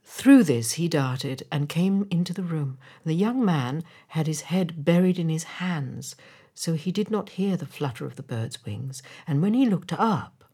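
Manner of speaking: plain reading voice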